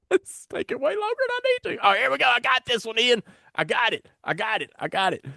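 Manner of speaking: high, fast and giddy, pitch way up; fumbling host finally finds the comment, frantic and high; frantic excitement. Real, not acted. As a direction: near squeaking with relief. Frantic searching, pitch shoots up.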